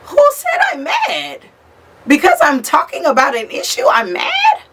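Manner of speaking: who said I'm mad, yelled way up high; indignant shout. Retold or imitated. Indignant retort to accusers.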